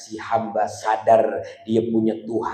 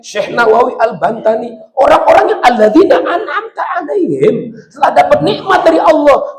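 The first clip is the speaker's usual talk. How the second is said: high, loud sermon build; full-force preacher; fiery preaching; 10 semitones higher, 5 dB louder than usual. Acted. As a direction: preach it hard to the crowd. Rising preaching on the saints.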